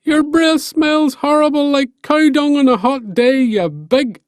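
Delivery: high, loud yelled insults; Finn shouting. Acted. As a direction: bellow insults across the sea. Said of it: "shouted Finn".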